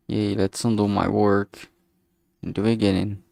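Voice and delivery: usual speaking voice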